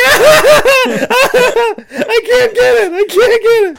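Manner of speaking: high squealing laugh-cry, very slow; giggling shriek. Retold or imitated. Narrator mid-mishap in the car, shrieking with laughter. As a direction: squeal it out through helpless giggles. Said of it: "giggling, crying like a little schoolgirl".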